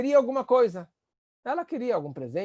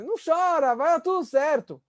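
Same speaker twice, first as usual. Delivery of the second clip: higher, breezy brush-off; dismissive parent; 7 semitones higher than usual. Retold or imitated. Parent telling crying child not to cry, breezy and higher. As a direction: shoo the tears away lightly. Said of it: "muitos pais falam essa frase".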